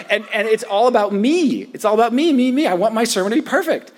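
put-on high, loud, childish me me me; mock self-centered whine. Preacher parodies his own selfishness to the congregation, mocking.

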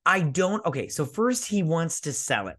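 ordinary talk, nothing marked

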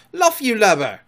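loud, high Irish-voiced shout; Irish-accented bellow to a crewmate; Irish pirate cry. A narrator's reading. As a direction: call out as an Irish sailor. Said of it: "cried an Irish voice that was Smee's".

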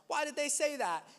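mock the others' outrage, pitch up; indignant disciples. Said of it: "everyone else is like".

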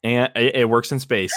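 ordinary delivery

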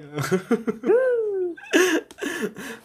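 long high-pitched laugh. Real, not acted. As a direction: let out a squealing laugh. Laughing at failed business memory.

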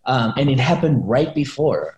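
ordinary talk, nothing marked

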